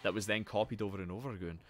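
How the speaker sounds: usual speaking voice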